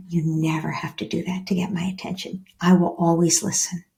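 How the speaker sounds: soft whisper; whispered reassurance. Shown as a demonstration. Parent hugging child, whispered reassurance. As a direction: whisper the promise close to the child.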